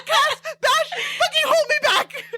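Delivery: high, laughing mock threat; hammed-up hold-me-back rant; mock fight-ready rant. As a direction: pretend brawler laughing high. Fan pretending to fight Vane.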